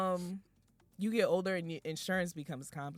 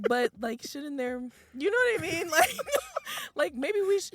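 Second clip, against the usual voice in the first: higher, louder incredulous protest; 10 semitones higher, 6 dB louder than usual. Retold or imitated. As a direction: push back in disbelief. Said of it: "I'm like".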